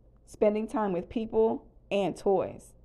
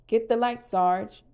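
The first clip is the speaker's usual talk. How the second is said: louder, higher call across the room; shouted order; 4 semitones higher, 5 dB louder, slower than usual. A narrator's reading. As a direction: call out a command to the sergeant. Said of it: "Buzz shouted".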